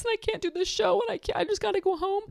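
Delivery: imitating tearful pleading. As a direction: rush it out high as a tearful excuse. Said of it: "She's like".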